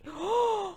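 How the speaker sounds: sudden intake of breath; shocked gasp. Real, not acted. Opening a surprise gift, shocked gasp.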